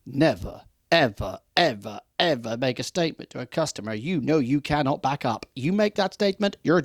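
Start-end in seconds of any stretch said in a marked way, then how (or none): 0.0-5.5 never, ever, ever hammered in a falling line; emphatic never ever. Shown as a demonstration. Trainer warning sales staff, stressing never ever. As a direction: drive home every never and ever. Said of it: "As I say".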